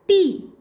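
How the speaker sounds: टी said high and loud with a hard "ʈ"; demo word. Shown as a demonstration. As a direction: model टी loudly. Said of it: "जैसे मास्टर". ("टी" ज़ोर से)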